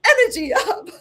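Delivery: high-pitched mock hype shout; excited holler through laughter; high-voltage shout. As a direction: imitate a hyped client, half laughing. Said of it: "would shout".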